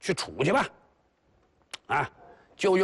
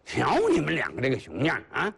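normal talking voice

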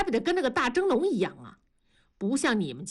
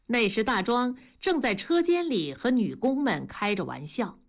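usual speaking voice

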